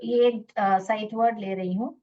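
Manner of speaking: normal talking voice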